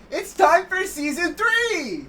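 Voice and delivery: high, loud and slow hype; excited announcement. Acted. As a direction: announce it like a big reveal. Big show announcement.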